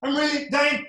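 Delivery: high, strained mock-rap wail; high-pitched wheezy singing-rapper imitation; asthmatic rapper. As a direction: gasp it out as a parody rapper. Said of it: "So the guy's like".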